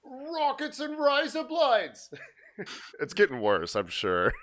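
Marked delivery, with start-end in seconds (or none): 0.0-2.1 put-on Australian accent. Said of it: "more like Rowdy, Rowdy Roddy Piper is what you're starting to sound like".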